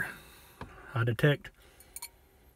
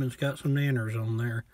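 ordinary talk

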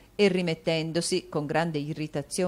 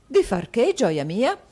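higher, louder alarmed question; startled husband; 6 semitones higher, 8 dB louder, slower than usual. A narrator's reading. Husband asking his wife, mock bewildered. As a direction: pretend he missed the point. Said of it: "chiese Mantalini".

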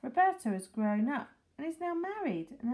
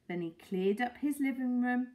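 plain reading voice

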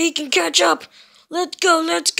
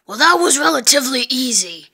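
ordinary talk, nothing marked

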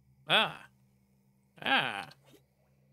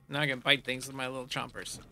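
usual speaking voice

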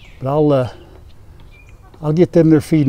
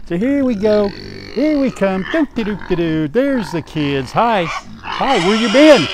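playful singsong; 5 semitones higher, slower than usual. Real, not acted. Singsong greeting to the animals.

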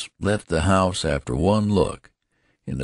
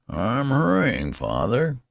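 put-on high-pitched, swooping old-man voice; old son's protest; 6 semitones higher than usual. A narrator's reading. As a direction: protest in a high, querulous lilt. Old son answers hurried father.

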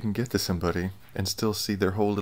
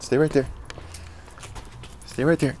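commanding a dog; 6 semitones higher than usual. Real, not acted. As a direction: firmly tell the dog to stay. Ordering the dog to stay.

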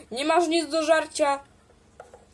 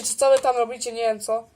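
normal talking voice

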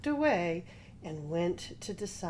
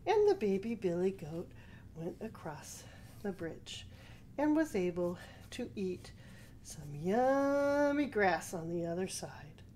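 ordinary delivery, nothing marked

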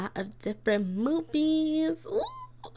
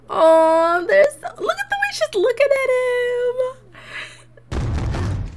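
loud, high squealing gush; 14 semitones higher, 8 dB louder than usual. Real, not acted. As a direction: squeal over the way she looks at him. Gushing at couple.

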